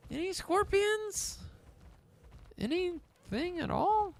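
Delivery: high, slow sing-song call into the wild. Acted. Gamer calling out to hidden creatures, high and sing-song.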